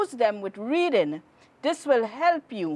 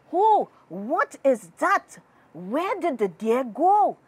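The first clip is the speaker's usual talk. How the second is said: startled; 5 semitones higher than usual. Acted. Startled hunter sees the old man.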